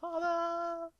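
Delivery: high, drawn-out mock cry for father; dramatic cry. Hero running toward his captured dad, hammed-up high cry. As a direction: call out to him in hammed-up slow motion.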